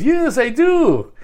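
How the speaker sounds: high, slow mock confession, in an odd put-on voice; mocking Carlos. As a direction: drag out a high, silly admission. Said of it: "He goes".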